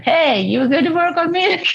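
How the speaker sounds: high-pitched mock protest, breaking into a laugh; playfully voiced complaint, pitched well up; inner guilty part. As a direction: pipe up as a neglected inner part. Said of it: "the guilty part said".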